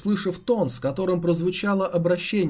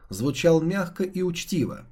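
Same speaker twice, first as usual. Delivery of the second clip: ordinary delivery, nothing marked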